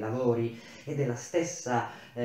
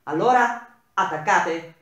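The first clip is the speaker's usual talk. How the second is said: curt, severe, much louder and higher question; stern; 5 semitones higher, 9 dB louder than usual. Acted. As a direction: demand a final answer. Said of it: "con un tono adesso più pacato, ma severo".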